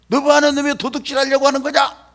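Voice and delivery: loud, high accusing shout; acted angry interrogation. Policeman accuses poor boy of stealing, acted angry shout. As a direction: shout it like an officer catching a thief.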